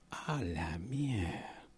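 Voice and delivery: slow hushed whisper; stunned whisper. Acted. Friend hearing the gossip on the phone, slow stunned whisper. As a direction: breathe it out in disbelief.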